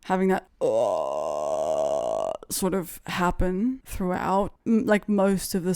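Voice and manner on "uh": very low sustained throat-sung drone; throat singing sound. Shown as a demonstration. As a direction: drop far down and hold a long throaty note. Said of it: "throat singing sound".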